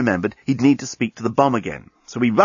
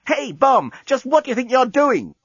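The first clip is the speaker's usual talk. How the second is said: indignant shout; 13 semitones higher than usual. Acted. Lucy shouts at bomb.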